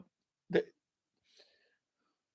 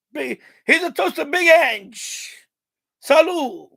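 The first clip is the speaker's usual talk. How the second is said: mock toast; 4 semitones higher, 6 dB louder, slower than usual.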